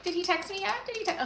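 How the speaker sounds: high, soft nervous checking; anxious fretting. Retold or imitated. Imitating herself waiting for a guy's text, anxiously. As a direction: fret like you're staring at your phone. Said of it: "I would be like".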